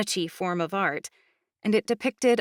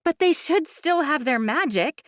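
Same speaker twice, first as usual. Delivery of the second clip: high, insistent objection; arguing; 8 semitones higher, 4 dB louder than usual. Acted. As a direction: push back insistently. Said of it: "Imogen argued".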